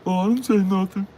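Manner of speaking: high, timid backing-down voice; high mocking coward; mock cowering bigot. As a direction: squeak it like a scared coward. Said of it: "Just be like".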